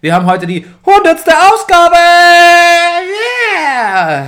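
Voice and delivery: loud, high celebratory announcing; excited announcement. Real, not acted. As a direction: shout out the big news. Announcing the hundredth episode.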